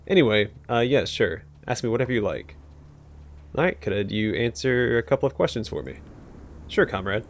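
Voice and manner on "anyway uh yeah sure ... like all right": normal talking voice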